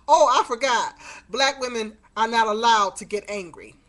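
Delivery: loud, biting sarcasm; mock him with fake surprise; loud sarcastic mock apology; sarcastic outburst. Sarcastic retort to her son.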